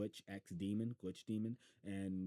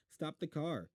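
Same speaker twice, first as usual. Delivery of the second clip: urgent call; 8 semitones higher, 3 dB louder than usual. Acted. Boy urgently shouting.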